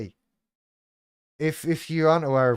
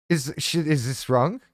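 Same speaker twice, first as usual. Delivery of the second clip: ordinary talk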